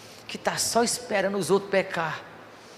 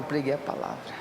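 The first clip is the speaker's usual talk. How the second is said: dropped to a hush; 6 semitones lower, 6 dB quieter than usual. Acted. Echo of the same phrase.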